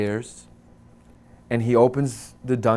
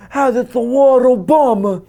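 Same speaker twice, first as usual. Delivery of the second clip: loud, much higher exclamation; excited shout; 12 semitones higher, 10 dB louder than usual. Retold or imitated. Guard proudly tells the others about Obama. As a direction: announce it triumphantly.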